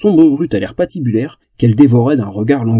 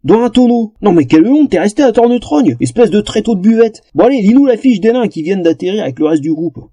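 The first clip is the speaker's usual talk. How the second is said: shouting; 6 semitones higher than usual. Acted. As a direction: yell at Bébert teasingly. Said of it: "arrête de crier".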